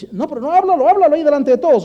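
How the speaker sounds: high, loud acted protest; open, insistent refusal. Preacher answering a child who wants a private word, insistent. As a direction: push it out for everyone to hear.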